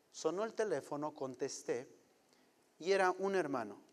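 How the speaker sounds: ordinary talk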